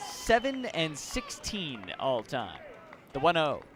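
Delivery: ordinary delivery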